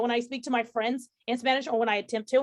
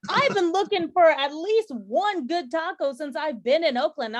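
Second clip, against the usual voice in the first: loud, slow, drawn-out protest; emphatic indignation; 4 semitones higher, 5 dB louder than usual. Real, not acted. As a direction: stretch out each point in mock outrage. Heated mock outrage over tacos.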